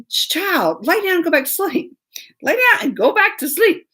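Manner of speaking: high, sing-out mock order; exasperated parent. Mom telling her panicking child to sleep, high and exasperated. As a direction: plead with a child in comic exasperation.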